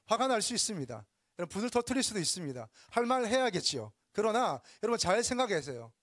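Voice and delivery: fiery pulpit delivery; forceful preaching. Real, not acted.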